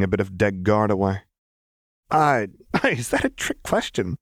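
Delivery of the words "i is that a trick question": laughing. A narrator's reading. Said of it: "she asked with a laugh".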